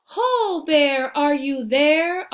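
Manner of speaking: high, loud calling. Acted. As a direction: call out into the storm for Bear. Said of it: "They call".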